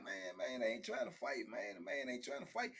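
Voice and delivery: low, hushed murmur; little whisper. Retold or imitated. Other people in the block comment aside, in a little whisper. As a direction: imitate bystanders muttering under their breath. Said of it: "little whisper like".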